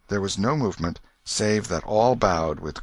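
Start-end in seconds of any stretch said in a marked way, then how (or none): none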